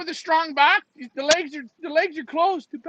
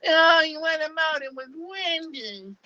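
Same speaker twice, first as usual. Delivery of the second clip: mock whining; 3 semitones higher than usual. Mocking a rival blaming the wind, whining. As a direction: whimper the excuse in pretend misery. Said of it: "I don't wanna hear the whining".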